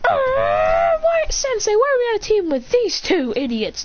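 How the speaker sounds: high-pitched complaint sliding down; acted girlish grumbling; whining protest. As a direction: whine at the teacher. Girls whine about team.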